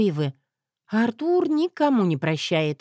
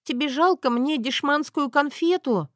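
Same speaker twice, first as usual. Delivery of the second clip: whiny Zoya; 8 semitones higher than usual. Acted.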